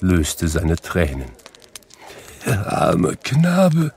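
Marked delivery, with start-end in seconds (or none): 2.4-3.9 put-on ache over the lost boy; mild tearful lament. A narrator's reading. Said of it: "und er weinte".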